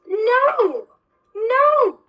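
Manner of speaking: sharp high shouts of refusal; shouting no. Retold or imitated. Retelling how she refused the husband, shouting no. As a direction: yell it out, high and loud. Retold refusal, slapping him.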